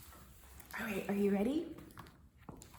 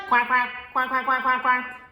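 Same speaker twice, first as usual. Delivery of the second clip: rapid run of mock quacks; duck quacking; 8 dB louder than usual. Storyteller as the duck heading for the pond, quacking. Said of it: "what does that duck say?".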